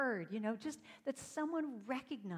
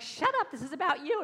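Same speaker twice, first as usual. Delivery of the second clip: much higher, louder shut up; snapping at critic; 6 semitones higher, 9 dB louder than usual. Retold or imitated. Singer telling off her inner critic, loud and high. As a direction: bark back at the inner voice.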